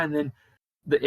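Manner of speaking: usual speaking voice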